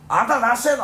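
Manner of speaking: high, loud scolding Osaka mom; loud nosy Osaka auntie. Retold or imitated. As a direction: shout at the kids like a nosy auntie. Friend's mother scolding kids. (大阪のおばちゃん風に叱る)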